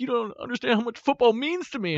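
put-on high, whiny boy voice; mock sulky kid. Son explaining why he cries, in a whiny mock-kid voice. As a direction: play the heartbroken son. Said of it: "He's like".

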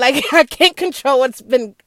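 loud words through a laugh; laughing helplessly. Real, not acted. Admitting she can't control the world, loud laughing exasperation.

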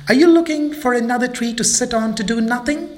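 high, fast jeering question; mocking tease. Friends jeering at lazy Mabel, fast and high. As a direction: rub it in.

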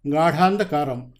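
plain reading voice

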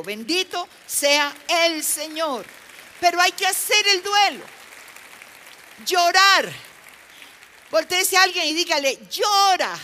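loud, high exhortation to the congregation; full-force preaching. Real, not acted. Preacher urging congregation to grieve, full-force preaching. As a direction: proclaim it forcefully, pitched high.

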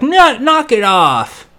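lightly voiced boy. Boy on the bus telling the others to stop, lightly voiced. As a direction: play a kid telling bullies to stop. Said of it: "some guy's like".

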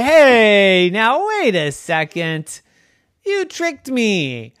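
slow, high, mock offended; mock-indignant Dora. Dora to friends who tricked her, slow and indignant. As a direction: drag it out, pretend hurt.

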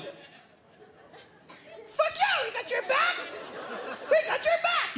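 put-on very high squeaky kid cheer; squealing child hype; excited little kids. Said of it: "we were all like".

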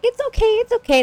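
very high, put-on reassuring voice; mock parental comforting, high-pitched; imitated soothing. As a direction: coo it to a crying child in falsetto. Parents soothing the crying daughter.

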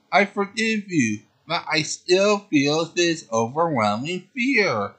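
heroine forgiving. Acted. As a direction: forgive him but stay frightened.

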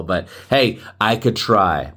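ordinary delivery

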